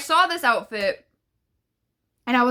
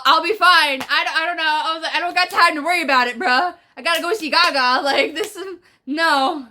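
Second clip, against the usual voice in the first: dismissive rush; 6 semitones higher, 5 dB louder than usual. Retold or imitated. Brushing off concern, rushing.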